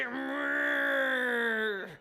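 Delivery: low, sustained "mm"; held-in rage growl. Shown as a demonstration. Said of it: "holding in rage".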